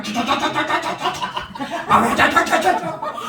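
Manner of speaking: high, laughing rattle of nonsense yelling; hammed-up high-pitched jabbering; imitated shrieking clamour. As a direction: mimic a noisy outburst in rapid syllables. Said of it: "막 소리가".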